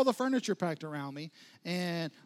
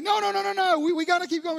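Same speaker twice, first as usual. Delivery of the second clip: high, loud panicked no no no; frantic refusal; 11 semitones higher, 7 dB louder, faster than usual. Retold or imitated. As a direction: shout it down fast, desperate to keep driving. Frantic refusal to stop driving.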